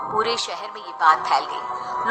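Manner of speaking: ordinary delivery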